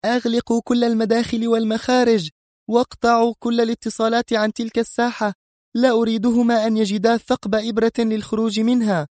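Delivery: furious high-pitched commands; سونيا. A narrator's reading. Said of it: "تهتف برجال المنظمة". (أوامر غاضبة بصوت عال الطبقة)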